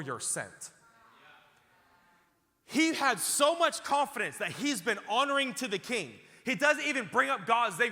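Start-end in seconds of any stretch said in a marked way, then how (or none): 2.6-7.9 loud, high preaching climax; preaching shout. Real, not acted. Preacher thundering to the church, loud and high. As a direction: build the fire to a shout.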